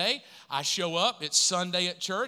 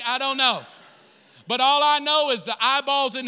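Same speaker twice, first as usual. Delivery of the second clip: loud, high, fervent preaching; preaching at full force; 6 semitones higher, 7 dB louder than usual. Acted. Pastor telling the congregation about God's provision, loud preaching. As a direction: build to a shouting sermon peak.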